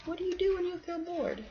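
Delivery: plain reading voice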